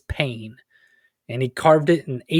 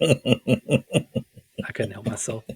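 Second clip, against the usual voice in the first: laughing; 4 semitones lower, 4 dB quieter, much slower than usual. Real, not acted. Laughing after joke.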